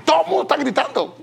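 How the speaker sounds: high, loud, fast exasperation; exasperated outburst. Real, not acted. Preacher describing a noisy home, exasperated loud outburst. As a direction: burst out at the chaos. Chaotic house everyone yelling.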